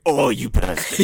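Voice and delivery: slow, loud mock indignation; mock outrage. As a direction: fake fury at a friend.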